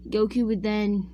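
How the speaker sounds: ordinary talk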